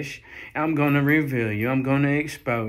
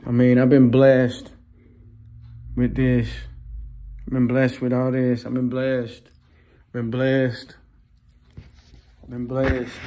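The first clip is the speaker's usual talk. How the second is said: much louder, calling it out; joyful loud praise; 5 dB louder than usual. Real, not acted. Telling viewers his blessings, loud and proud. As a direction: proclaim it like testimony.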